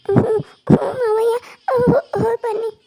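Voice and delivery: heavy weeping as the uncle leaves; sobbing. Acted. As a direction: stammer through the tears. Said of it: "ಯಾಕೆ ಅಳ್ತಾ ಇದೀಯಾ?".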